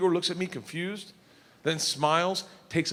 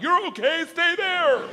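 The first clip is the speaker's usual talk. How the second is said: stern bark; 13 semitones higher, 6 dB louder, slower than usual. Retold or imitated. Cop's tone imitated.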